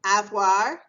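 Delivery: Avoir, ah-vwahr, loud; French word, announced. Shown as a demonstration. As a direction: present Avoir clearly and loudly. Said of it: "is or".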